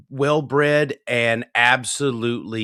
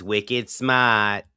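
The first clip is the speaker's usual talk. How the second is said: slow, exaggerated Boston accent. Joking about the lady who sounds Bostonian, Boston accent. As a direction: drop the R in a mock Boston accent. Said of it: "Well, the Boston accent".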